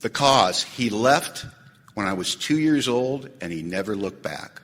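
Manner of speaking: low, measured, with weighty pauses; reading written inventory. Acted. Speaker reading out his resentment at his father, low and deliberate. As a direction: read it out starkly, slowing and dropping to the end. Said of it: "This is how much I wrote".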